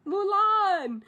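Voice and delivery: high, drawn-out calling of a name; loud acted call into the distance; imitated searching call. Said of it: "he's looking for Mulan".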